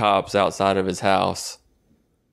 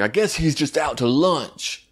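slow, pitched-up mocking delivery; mock bitter line; 7 semitones higher than usual. Mocking the hero's jab at God, slow and high. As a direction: drag out the sarcastic line. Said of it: "And he's like".

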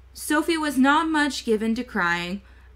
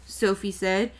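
plain reading voice

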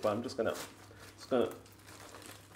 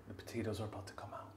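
quick, much softer hush; hushed and fast; 3 semitones lower, 16 dB quieter, faster than usual. Real, not acted. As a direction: rush it under your breath. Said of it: "you gotta be quiet".

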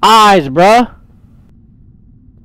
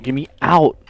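ordinary delivery

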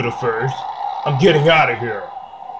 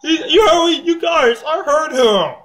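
excited; 9 semitones higher than usual. Real, not acted. Excitedly reporting what he heard.

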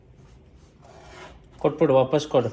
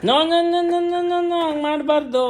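urgent no no no; 4 dB louder, slower than usual. Real, not acted. Mother halting her toddler at the light, high alarmed no's.

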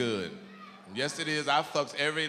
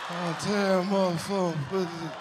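slurred Ali voice; 3 semitones higher than usual. Acted.

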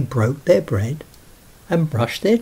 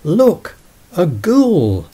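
high, loud alarmed cry; frightened cry; 6 semitones higher, 6 dB louder, slower than usual. Acted. Girls crying out at the ghoul in fright. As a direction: shout it out in fright. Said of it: "The girls all cried".

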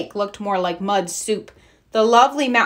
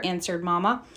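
usual speaking voice

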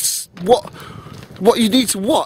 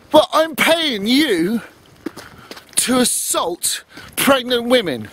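slow sarcastic emphasis; 3 semitones higher, slower than usual. Real, not acted. Outraged point about paying police.

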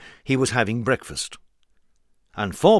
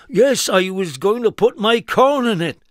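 higher, louder farmer voice; puzzled farmer; 9 semitones higher, 6 dB louder than usual. Acted. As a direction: answer a bit baffled. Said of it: "said the farmer".

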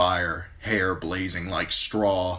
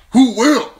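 put-on higher, louder rhetorical question from the old lord; Lord Commander's question; 15 dB louder than usual. Mormont ends his point.

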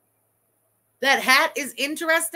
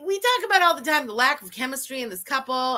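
usual speaking voice

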